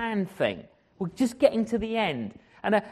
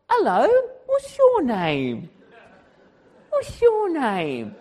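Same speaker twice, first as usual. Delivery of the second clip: exaggerated friendly greeting; 4 semitones higher, slower than usual. Preacher showing executives how to greet a cleaner, exaggerated. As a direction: draw out each word in an overdone greeting.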